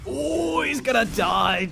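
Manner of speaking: high, loud alarm; sudden rising alarm; excited. Real, not acted. Sudden fight in bot lane.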